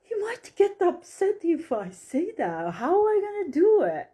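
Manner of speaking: high-pitched, flustered objection, quickening at the end; retold worried protest. Medium protests to the universe about telling her client, worried protest. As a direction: act out pleading back, pitched well up.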